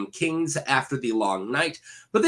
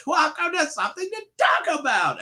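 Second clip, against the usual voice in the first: high-pitched mock scream of the show intro; imitating daughter's scream; 12 semitones higher than usual. Daughter yelling the channel's intro, high mock scream. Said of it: "my daughter just screaming".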